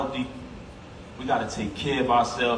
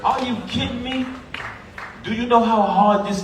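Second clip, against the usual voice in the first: impassioned; 7 semitones higher than usual. Real, not acted. Passionate tribute to his brother.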